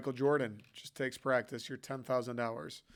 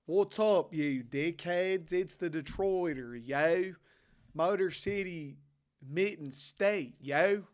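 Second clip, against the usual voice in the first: exaggerated Philly accent, higher pitched; 4 semitones higher, slower than usual. Shown as a demonstration. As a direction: put on a drawn-out Philly accent. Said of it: "man, my Philly accent".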